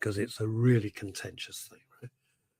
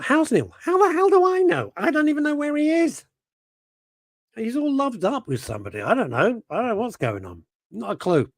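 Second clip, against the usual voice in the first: much higher exasperated squeak; mock viewer voice; 11 semitones higher than usual. Reading a viewer's chat in a high mocking voice. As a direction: squeak it like a flustered friend. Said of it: "Kimmy Lashmar".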